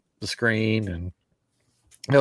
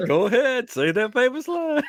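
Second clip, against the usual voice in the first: high, coaxing mock voice; imitating friend; 13 semitones higher than usual. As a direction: tease it out. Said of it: "You're like".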